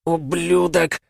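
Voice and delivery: high, spat-out werewolf hiss; hissed curse. Acted. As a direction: hiss it through the pain. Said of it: "прошипел оборотень". (злобно прошипеть)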